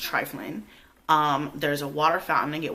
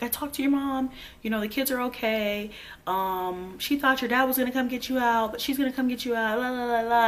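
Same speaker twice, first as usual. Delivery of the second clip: higher-pitched mimicry of a sister; imitating sister; 7 semitones higher than usual. Sister on the phone reporting on the kids, mimicked. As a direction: rattle it off high, tailing into dismissive la-las. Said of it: "the only person that would answer is my sister".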